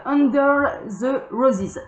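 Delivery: slow English with a strong French accent. Real, not acted. Tarot reader naming her English card deck, French accent. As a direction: pronounce the English haltingly through a French accent. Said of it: "mon accent anglais qui est absolument pitoyable".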